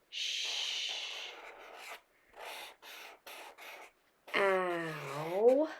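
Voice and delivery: teacher sounding out "shower". Shown as a demonstration. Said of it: "that funny eh, er digraph".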